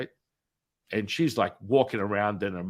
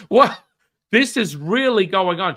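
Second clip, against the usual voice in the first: much higher and louder, laughing in shock; stunned disbelief; 9 semitones higher, 9 dB louder than usual. Retold or imitated. Reacting to the mother calling him a healer, stunned and loud. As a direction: burst out in astonished disbelief.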